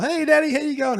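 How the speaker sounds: put-on very high, loud child's greeting; Jake. Son in a sequin dress greeting his father, high and excited. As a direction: imitate an excited kid calling out to Dad. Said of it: "here's Jake walks out the front of the house".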